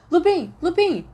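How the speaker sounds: high, loud call across the room. Acted. Third-year calling Lupin from the portrait hole, loud. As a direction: shout his name to catch him.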